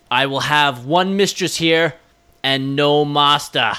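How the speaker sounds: mild mock royal command; imitating Cate Blanchett. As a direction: declare it as a queen who bows to no one. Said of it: "the way she delivers that line".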